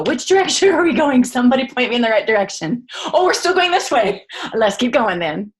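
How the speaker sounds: high, loud, bewildered calling out; lost and flustered. Retold or imitated. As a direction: act out being lost in the crowd. Retelling her own confused moment.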